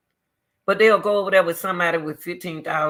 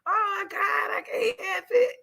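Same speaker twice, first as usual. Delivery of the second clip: high-pitched squeal of laughter she can't stop; helpless laughter. Real, not acted. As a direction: laugh it out, losing control. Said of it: "I'm laughing".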